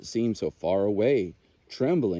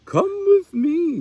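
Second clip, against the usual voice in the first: high, slow coaxing mother voice; a mother owl gently calling her chick; 8 dB louder, slower than usual. A narrator's reading. Mother owl coaxing Little Owl out of the nest, high and slow. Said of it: "Little Owl's mother said".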